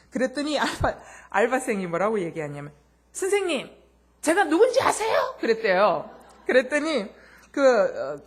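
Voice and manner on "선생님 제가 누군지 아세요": high, loud, laughing cheeky retort; a part-timer mocking a rude customer, laughing. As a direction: throw his words back at him with glee. Part-timer turns the tables.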